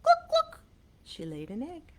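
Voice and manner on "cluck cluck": very high, loud hen cluck. Acted. Showing kids how a hen clucks, high and loud. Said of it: "This hen makes it look easy".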